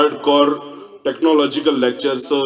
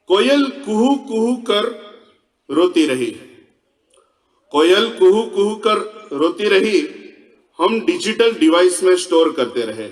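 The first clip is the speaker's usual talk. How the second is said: measured recital, each phrase set apart; verse recitation; 3 semitones higher than usual. Acted. Speaker recites a koel conservation verse to science audience, poetry recital. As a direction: declaim it as stage poetry with pauses.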